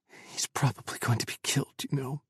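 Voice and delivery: soft whispered croak. Acted. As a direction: whisper it, grim. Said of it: "Chris croaked".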